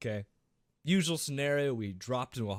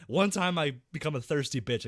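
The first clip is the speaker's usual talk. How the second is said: ordinary talk, nothing marked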